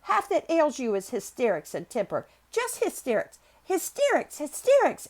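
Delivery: loud scolding that leaps high; angry shouting. A narrator's reading. Girl scolding hysterical boy, angry shouting. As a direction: hammer each phrase, pitch jumping up. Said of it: "shouted Mary".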